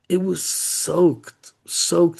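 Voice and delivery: drawn-out, hard stress on "soaked"; "soaked" leaned on for dramatic effect; emphatic SOAKED. A narrator's reading. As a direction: stretch "soaked" out, then hit it harder. Storyteller stressing how wet it was.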